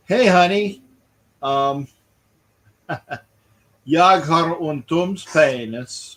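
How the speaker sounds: high, slow mock Swedish accent through a laugh; John Candy Swedish line. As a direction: laugh while hamming up the Swedish. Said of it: "I'm just doing what John Candy said".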